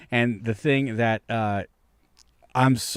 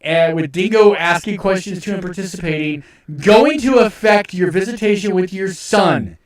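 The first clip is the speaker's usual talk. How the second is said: higher, louder incredulous rant; exasperated rant; 6 semitones higher, 6 dB louder than usual. Real, not acted. Streamer ranting at Ralph's claim, higher, louder exasperated disbelief. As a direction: disbelief boiling over at the argument.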